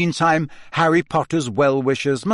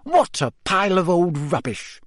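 higher, dismissive exclamation; amused scorn; 5 semitones higher than usual. A narrator's reading. Hermione throws the magazine aside, amused scorn. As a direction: toss it off with light disdain.

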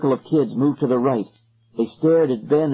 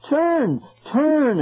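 very high, drawn command; girl repeating the call; 13 semitones higher than usual. A narrator's reading. Girl in the circle echoing boy, high command. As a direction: echo the call high and insistent. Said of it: "a girl repeated".

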